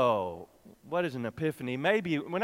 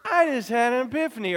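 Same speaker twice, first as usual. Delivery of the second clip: excited; 10 semitones higher, 7 dB louder than usual. Shown as a demonstration. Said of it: "You could go".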